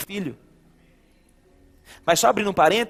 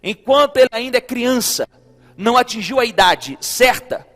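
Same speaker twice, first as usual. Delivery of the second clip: loud, emphatic reading of scripture; forceful preaching; 7 dB louder than usual. Real, not acted. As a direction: press each phrase out loudly to the church. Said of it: "preaching at full force". (pregação enfática e alta)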